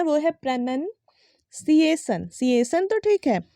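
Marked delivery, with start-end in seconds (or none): 0.3-2.3 "प्रोनाउंसिएशन" slowly as pruh-nun, see-ay-shun; correct pronunciation. Shown as a demonstration. Teacher gives the right way, "प्रोनाउंसिएशन" as pruh-nun-see-ay-shun. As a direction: split "प्रोनाउंसिएशन" after a short "nun". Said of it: "इसका जो सही उच्चारण है".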